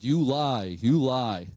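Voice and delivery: mild acted anger, falling at the end; angry accusation. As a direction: quote her accusing him, each word firm. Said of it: "she looked so angry at him".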